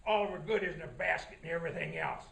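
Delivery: low, greedy wolf telling his plan; low wolf voice, hungry and sly. Acted. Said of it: "And he said".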